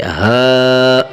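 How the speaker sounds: full heavy ہا. Shown as a demonstration. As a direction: fill the mouth for ہا. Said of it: "اسے موٹا کریں گے".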